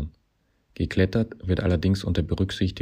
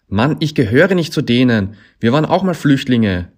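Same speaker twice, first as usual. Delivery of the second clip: high, loud, wailing objection; desperate protest; 7 semitones higher, 10 dB louder than usual. A narrator's reading. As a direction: cry it out in exasperation. Desperate protest in acted quarrel.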